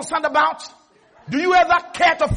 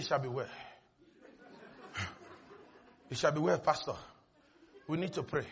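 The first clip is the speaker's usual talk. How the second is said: much softer, lower, half laughing; mock timid whisper; 12 semitones lower, 19 dB quieter, faster than usual. Preacher mimicking a frightened member quietly asking to pray. Mocking fearful churchgoer.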